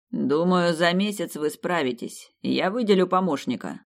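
usual speaking voice

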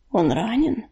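soft, subdued worry; hollow, muted. A narrator's reading. Leader asks if the other is wounded, muted. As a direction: keep it muted and dull. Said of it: "глухо спросил он".

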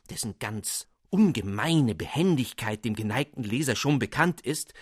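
usual speaking voice

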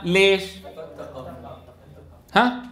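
ordinary talk

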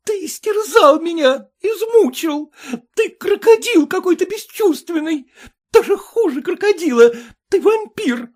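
high, loud hysterical accusations. A narrator's reading. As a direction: rage and sob at him. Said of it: "с ней началась истерика".

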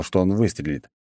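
ordinary delivery

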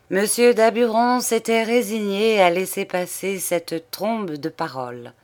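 usual speaking voice

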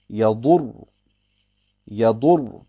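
Yadur mit dunklem R am Ende; dunkles Ra. Shown as a demonstration. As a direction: lass das R in Yadur dunkel ausrollen. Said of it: "wird's dunkel ausgesprochen". (Yadur mit dunkel gefärbtem End-R)

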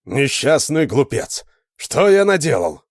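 slow, despairing cry; anguished exclaim. A narrator's reading. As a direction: cry out in remorse. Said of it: "воскликнул он".

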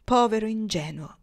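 ordinary delivery, nothing marked

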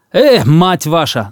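slow, loud swearing; angry cursing. Acted. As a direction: curse like an officer. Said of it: "заругался Эмархан".

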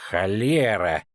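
angry bark. A narrator's reading. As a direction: snap out the curse in fury. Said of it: "рявкнул Русти".

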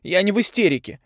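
high, loud furious denial; girl yelling in anger; yelled. A narrator's reading. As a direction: shout it back at him. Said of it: "заорала Тави".

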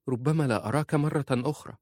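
high, sorrowful appeal; worried wife. A narrator's reading.

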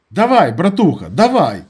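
high, loud cry of encouragement; shouted urging. A narrator's reading. Narrator shouting into the phone to his friend, urgent. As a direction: shout to spur your buddy on. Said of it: "прокричав я в слухавку".

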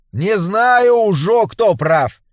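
brash village swineherd, barging in; rustic swineherd voice. A narrator's reading. Swineherd butts in.

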